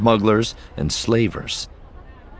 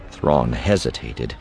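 usual speaking voice

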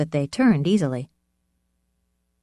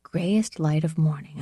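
usual speaking voice

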